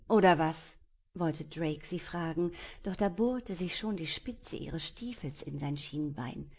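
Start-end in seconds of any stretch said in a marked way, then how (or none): 0.1-0.7 mocking challenge. Pirate mocks her threat.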